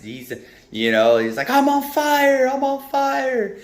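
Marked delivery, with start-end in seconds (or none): 1.5-3.6 very high, drawn-out mock panic; Ricky Bobby. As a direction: wail it slowly in a hammed-up panic. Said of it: "Ricky Bobby from Talladega Nights".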